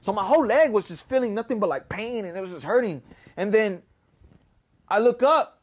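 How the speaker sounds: ordinary talk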